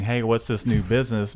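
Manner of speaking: ordinary talk, nothing marked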